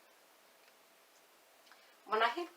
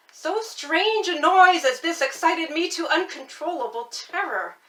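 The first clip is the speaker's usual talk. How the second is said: rising frenzy; 7 semitones higher, 13 dB louder than usual. A narrator's reading.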